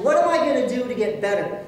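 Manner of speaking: usual speaking voice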